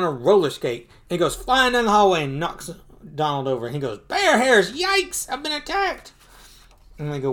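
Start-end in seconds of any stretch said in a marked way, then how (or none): 4.1-6.1 high-pitched comic yelp of alarm; startled alarm. Acted. Donald knocked over by the bear, high comic alarm. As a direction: cry out as if just ambushed.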